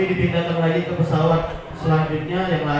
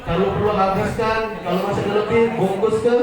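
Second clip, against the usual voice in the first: ordinary delivery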